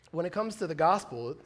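usual speaking voice

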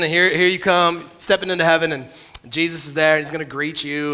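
ordinary delivery

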